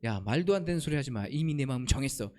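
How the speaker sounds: low, understated dismissal; a hint of curt divine refusal; lightly voiced God. God imagined refusing Abraham's prayer, low and understated. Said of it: "하나님께서 뭐라고 얘기해요?".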